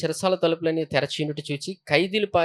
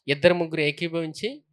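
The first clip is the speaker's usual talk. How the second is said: normal talking voice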